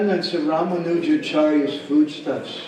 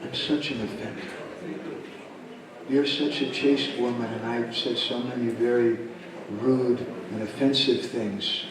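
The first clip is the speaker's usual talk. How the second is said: soft, low plea for forgiveness; subdued confession, voice dropped; penitent begging; 3 semitones lower, 8 dB quieter than usual. Acted. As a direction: beg quietly, full of remorse. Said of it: "he begged".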